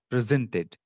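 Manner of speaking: "presented" as a verb, pre-ZENT-ed; light "pre", strong "ZENT" in "presented"; stress on second syllable. Shown as a demonstration. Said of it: "stress will be on the second part".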